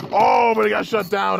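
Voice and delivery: high excited exclamation. Real, not acted. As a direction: blurt out the sudden knockout. Sudden knockout in battle.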